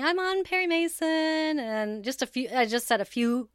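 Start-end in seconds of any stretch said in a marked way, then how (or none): 0.0-1.6 high, sing-out mock announcement; retold announcement. Host quotes her own posted video about her role, retold announcement. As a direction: re-enact a proud video announcement, pitched way up. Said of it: "just saying".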